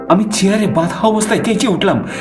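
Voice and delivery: usual speaking voice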